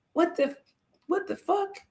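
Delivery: high-pitched, halting confused question; imitated bafflement. Hurting children meet their own feelings, imitated bewilderment. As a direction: mimic a bewildered kid, voice rising high.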